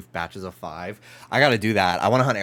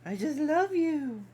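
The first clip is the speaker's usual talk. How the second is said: very high cooing pet voice. Real, not acted. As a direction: coo lovingly at a puppy. Cooing at dog.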